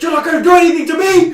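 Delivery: fast, high, very loud mimicked outburst; mock screaming. Woman to Neil Breen when he appears, mock screaming. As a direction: scream it as the defiant character. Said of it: "starts screaming at him".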